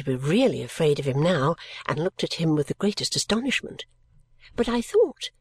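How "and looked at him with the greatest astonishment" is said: ordinary delivery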